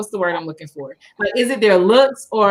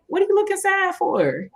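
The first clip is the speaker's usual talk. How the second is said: lightly imitating the worker, pitched up; a friendly, pitched-up nudge to cheer up; mock worker voice, higher and chirpy; high imitated Walmart worker; 9 semitones higher than usual. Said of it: "he was like".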